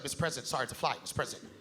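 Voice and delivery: low, hushed apologetic stammering; nervous interviewer. Acted. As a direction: apologize timidly under your voice. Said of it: "got all nervous".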